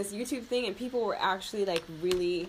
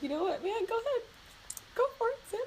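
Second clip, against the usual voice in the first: much higher, encouraging quote voice; parents' voice; faster than usual. Retold or imitated. Parents telling her to go for it, high encouraging voice. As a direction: cheer her on like a proud parent. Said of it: "they're just like".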